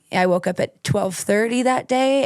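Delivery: ordinary delivery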